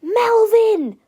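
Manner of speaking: high, loud horrified cry; George. A narrator's reading. George sees Melvin betray them, crying out in horror. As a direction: cry the name in shock. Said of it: "cried George, horrified".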